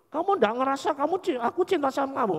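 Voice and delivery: high fast incredulous question; surprised protest. Retold or imitated. Lover can't believe girlfriend doesn't feel it, high and fast. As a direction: sputter in disbelief.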